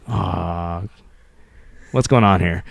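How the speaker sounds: a hint of a disapproving older guy, low and soft; grumpy Gen Xer. Dad voicing his Gen X side over unicorn ice cream, mildly grumbling. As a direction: lightly play your skeptical old-school side. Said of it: "the late Gen Y or Gen Xer of me goes like".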